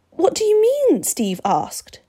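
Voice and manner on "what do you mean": high, upset, raised question; acted trembling voice. A narrator's reading. Steve questioning the vampire who rejected him, trembling and high. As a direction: ask in high-pitched alarm, voice trembling. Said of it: "His voice was trembling".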